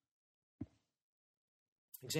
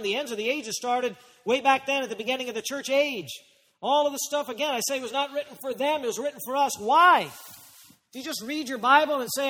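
high-pitched, driving pulpit exposition; urgent sermon delivery in a raised pitch; impassioned preaching; 6 semitones higher, 5 dB louder than usual. Real, not acted. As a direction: preach it with rising insistence. Preaching at full force.

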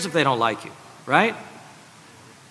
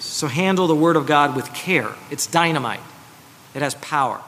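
normal talking voice

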